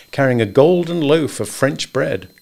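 ordinary delivery

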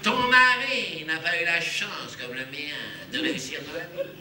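slow, smug, pitying; a hint of a snooty rich woman; condescending. Rival talking down to Simone, slow and smug. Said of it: "elle me fait comme ça".

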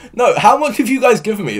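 can't believe the total; excited disbelief. Real, not acted. Streamer asking chat, giddy at how much they gave.

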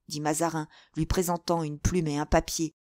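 ordinary delivery

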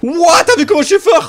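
loud, high gloating; excited brag. Real, not acted. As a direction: crow about the win. Bragging after a kill.

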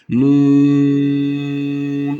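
Nun held four elif, chanted; long nun. Shown as a demonstration. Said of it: "dört elif miktarı uzatarak okuyoruz". (Nun dört elif uzatarak)

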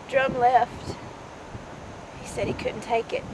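low, quiet, halting recollection; subdued, mournful remembering; hushed grief. Acted. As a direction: let long pauses sit between soft phrases. Mother recounts her daughter's death.

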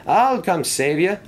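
high, slow calling out; yelling. A narrator's reading. Engine calls to a stranded engine in a storm, yelling. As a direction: stretch it out as a high call. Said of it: "he yelled".

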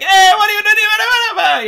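high, loud, drawn-out Italian shout; exaggerated Italian accent. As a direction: holler it like a cartoon Italian. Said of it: "exaggerated Italian accent".

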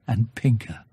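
ordinary delivery, nothing marked

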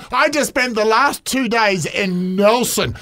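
ordinary talk, nothing marked

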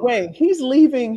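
ordinary delivery

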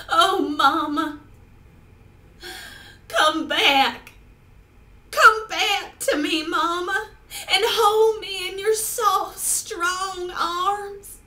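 overacted crying plea; tragic heroine sorrow. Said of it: "performing a monologue for you".

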